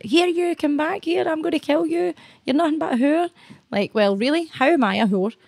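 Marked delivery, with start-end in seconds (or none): 0.0-3.3 imitating a street aggressor, voice pitched up; mock threatening shout. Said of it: "chasing me through the street, fucking want to leather me".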